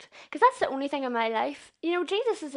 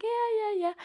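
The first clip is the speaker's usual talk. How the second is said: mock dismissive yeah; 10 semitones higher than usual. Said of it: "And I was like".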